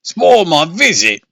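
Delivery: shriek. Acted. Father shrieking at the monster host. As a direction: shriek it in outrage. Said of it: "Dad shrieked".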